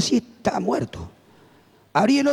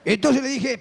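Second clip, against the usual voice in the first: normal talking voice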